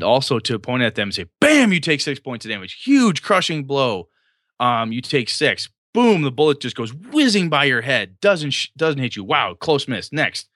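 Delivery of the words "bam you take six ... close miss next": higher, punchy action narration; animated game master. Shown as a demonstration. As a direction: punch the impact words and sell every blow. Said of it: "to vary your tone of voice as a game master".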